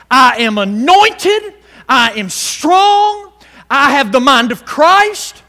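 slow, loud and high proclamation of scripture. Shown as a demonstration. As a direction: preach each claim with drawn-out force. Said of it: "you say".

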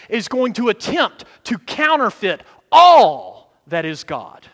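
preaching at full force. Acted. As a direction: drive the point home to church.

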